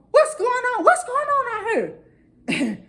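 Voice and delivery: high, startled demand; mama rushing in, high and sharp; mother bursting from the bedroom in high alarm. Retold or imitated.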